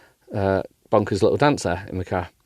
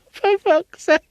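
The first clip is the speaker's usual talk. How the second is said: high laughing exasperation; slower than usual. Real, not acted. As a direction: crack up at yourself. Laughing at herself.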